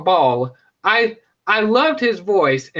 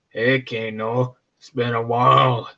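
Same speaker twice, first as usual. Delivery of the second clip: Kabal's voice; 7 semitones lower than usual. Retold or imitated. Kabal greeting Kano again, low and slow. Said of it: "He's like".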